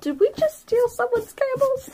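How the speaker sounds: high-pitched baffled question; puzzled, pitched well up; very confused. Acted. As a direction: ask it in bewildered disbelief. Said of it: "very confused and be like".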